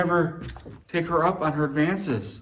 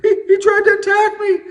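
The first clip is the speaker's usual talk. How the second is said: much higher, louder, with a mock sob and laugh; fake accusation; 7 dB louder than usual. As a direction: play the wronged wife crying falsely. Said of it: "She said".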